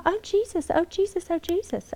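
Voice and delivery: breathing out a frightened plea; anxious prayer. Retold or imitated. Mother hearing Haiti danger from husband, soft frightened prayer. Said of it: "and I'm going".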